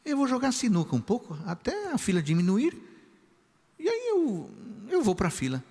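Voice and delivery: put-on high, breezy teenage voice; boy telling the pastor his plan, high and easygoing; boy's carefree thought. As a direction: think it offhand like a carefree kid. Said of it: "eu pensei assim".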